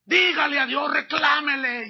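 2 shout it as a command; 1 urgent exhortation. Real, not acted. 4 preacher urging the church to confront God, shouting.

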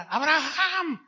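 high, slow mocking call of Abraham's name; high drawn-out mock call; mocking old wife calling. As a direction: drag the name out like a teasing old woman.

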